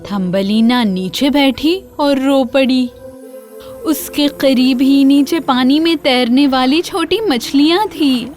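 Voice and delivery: ordinary delivery, nothing marked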